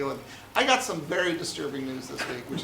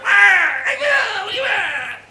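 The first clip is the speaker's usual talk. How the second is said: very high, loud squeaky creature; screechy little scavenger; Jawa voice; 12 dB louder, slower than usual. Retold or imitated. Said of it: "The Jawas like".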